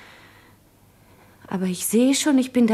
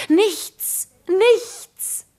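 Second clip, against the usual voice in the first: two high, emphatic cries; bitter outburst; 9 semitones higher than usual. Acted. Woman decrying what a human counts for, bitterly. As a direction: throw the word out high, then higher.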